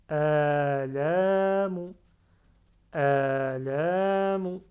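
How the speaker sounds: ALAMU very slow and loud, both a's held; two prolonged vowels. Shown as a demonstration. Teacher reading the word aloud, both a vowels held long. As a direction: stretch "a" and "la" in "Alamu", short final mu. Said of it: "on prolonge le alif avec fatha".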